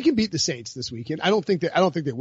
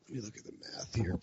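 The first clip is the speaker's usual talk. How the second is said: whispered aside; 8 semitones lower, 17 dB quieter than usual. Real, not acted. Aside while checking betting odds.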